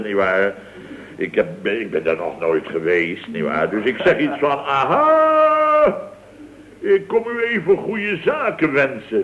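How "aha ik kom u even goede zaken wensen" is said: jovial greeting. Retold or imitated. Boss greets canteen lady jovially.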